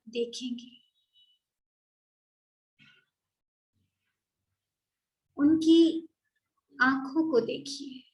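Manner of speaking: measured, unhurried meditation voice; guided meditation. Acted. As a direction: gently guide listeners through a visualisation, leaving space after each phrase.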